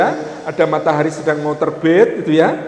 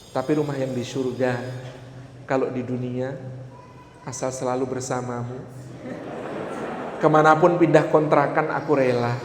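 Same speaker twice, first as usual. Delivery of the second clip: soft, low voice of an acted wife; tender devotion; 5 semitones lower, 8 dB quieter than usual. Devoted wife tells husband any rented house will do, tender devotion. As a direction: confide it quietly, as a loving promise.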